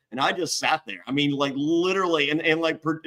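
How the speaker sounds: ordinary talk, nothing marked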